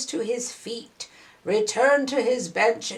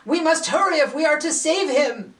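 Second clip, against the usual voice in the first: urgent villager; 6 semitones higher, 4 dB louder than usual. Acted. Villager urging rescue.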